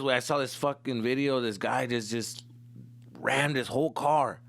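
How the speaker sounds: usual speaking voice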